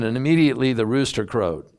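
ordinary talk

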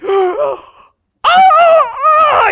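very high, loud gasping cry; retold startled shout; shocked gasp cry. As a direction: realize it with a jolt. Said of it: "And I was like".

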